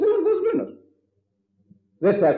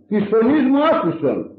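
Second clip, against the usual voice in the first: raised, higher cry of the dead man; calling out; 6 semitones higher, 3 dB louder than usual. Acted. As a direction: call out to God for a hearing. Priest voices the dead calling out.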